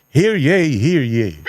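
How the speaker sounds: a hint of a town crier calling out; mock herald cry. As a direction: call it like a herald, half in jest. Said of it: "isigaw mo yung pangalan niya". (pabirong sigaw ng tagapahayag)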